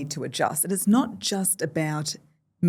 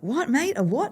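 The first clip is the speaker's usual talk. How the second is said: puzzled workers; 8 semitones higher than usual. Retold or imitated. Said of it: "they were like".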